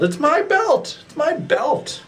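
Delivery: It's my belt wailed very high and slow; high whine. Acted. Wrestler whines at rival over his belt, high pitched wail. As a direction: whine it like a child who lost a toy.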